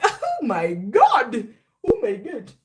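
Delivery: drawn-out slow gasp of disbelief; mock shock. Reader realizing she skipped ten days, slow mock shock. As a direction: stretch the first oh my God in disbelief. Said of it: "And I was like".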